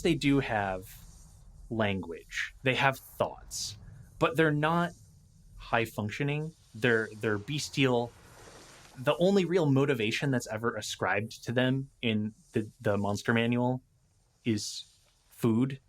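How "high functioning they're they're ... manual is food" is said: ordinary talk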